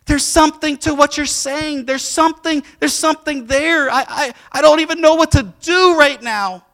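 high, loud, choked-up retelling of a crying man; mock tearful, high and loud; imitating someone crying. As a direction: play the barber overcome and tearful. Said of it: "He is crying".

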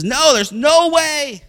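loud, high, slow swearing no; swearing denial. Acted. Peter swearing to the crowd, loud and slow. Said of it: "And he swears".

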